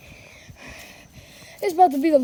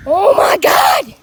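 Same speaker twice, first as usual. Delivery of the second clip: slow, loud drawn-out shout; frightened shout; 7 dB louder, much slower than usual. Real, not acted. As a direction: yell it out in fright. Fright while fleeing.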